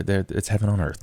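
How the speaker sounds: ordinary talk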